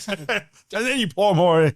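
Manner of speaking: high, drawn-out mock British accent; amused, uncertain stab at British or Scottish. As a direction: stretch it out in a comic British imitation. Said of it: "I don't think I got the right accent".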